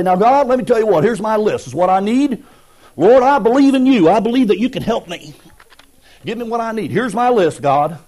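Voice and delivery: loud, high, bossy demand to God; mock proud churchgoer waving his list. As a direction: order God around like a boss. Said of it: "come walking up all proud".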